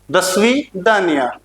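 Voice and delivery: usual speaking voice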